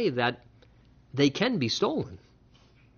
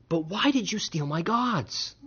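incredulous quote; 3 semitones higher, 4 dB quieter than usual. Retold or imitated. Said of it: "just consider that statement".

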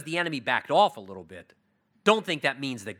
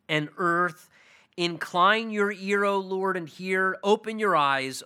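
slow, louder, raised-pitch prayer; solemn prayer reading; 4 semitones higher, 3 dB louder, slower than usual. Acted. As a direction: lift it up to God slowly and grandly. Reads Hezekiah's prayer aloud.

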